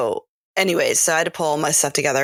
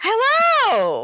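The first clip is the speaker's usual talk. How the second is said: excited squeal. Acted. Greeting listeners in England.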